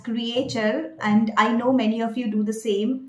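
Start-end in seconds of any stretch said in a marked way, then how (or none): 0.0-0.9 "creature" split into three syllables, kree-AY-cher; spelling pronunciation. Shown as a demonstration. Coach showing the mistake from spelling, spelling pronunciation. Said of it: "you may like to pronounce it as".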